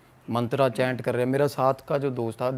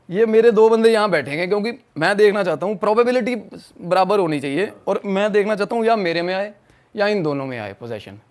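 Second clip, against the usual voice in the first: higher, louder, argumentative retelling; retold insisting; 6 semitones higher, 5 dB louder than usual. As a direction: push the demand, pitched up and forceful. (ज़ोर देकर, ऊँची आवाज़ में बहस)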